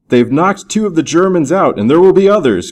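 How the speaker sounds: narrated cry of triumph, voice lifted; excited cry. A narrator's reading. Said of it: "cried Captain King".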